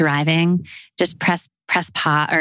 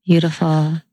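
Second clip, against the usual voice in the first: guided meditation. Acted. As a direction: ease the listeners gently into rest. Leads a breathing meditation.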